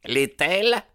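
very high-pitched outraged question; indignant cry. A narrator's reading. Rival challenging that she is free, crying out. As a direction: exclaim it, voice leaping up. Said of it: "s'écria Baldwin".

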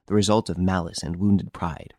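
usual speaking voice

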